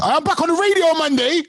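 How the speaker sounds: high-pitched, gleeful announcement; excited. Real, not acted. Co-host plugging his radio return to friends, excited. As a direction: sing it out high, thrilled with the news. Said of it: "I'm so excited".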